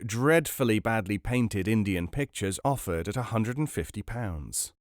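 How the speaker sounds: usual speaking voice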